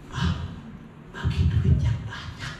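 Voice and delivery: soft whisper into the husband's ear. Retold or imitated. Wife whispering to her husband for money, soft whisper. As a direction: breathe it close and low like a secret. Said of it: "ngomongnya pelan-pelan".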